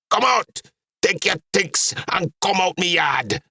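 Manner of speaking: high-pitched angry outburst; erupting in anger. A narrator's reading. Dad orders his daughter out of the yard, erupting. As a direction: erupt as a furious Trinidadian father. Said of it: "Dad looked at me and erupted".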